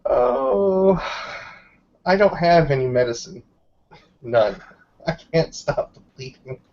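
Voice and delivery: ordinary talk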